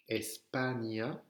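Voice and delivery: slow ESPAGNA with a GN sound, rising; Ñ comme GN. Shown as a demonstration. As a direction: stretch out Espagna for learners. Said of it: "un N avec une vague au-dessus". (Espagna lentement, avec gn)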